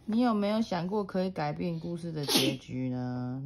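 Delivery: plain reading voice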